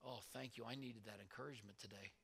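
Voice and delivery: soft, hushed thanks to God; hushed thanksgiving, almost to yourself; quiet grateful prayer. Shown as a demonstration. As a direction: murmur it like a private prayer. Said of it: "and you say".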